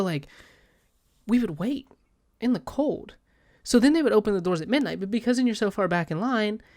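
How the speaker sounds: ordinary delivery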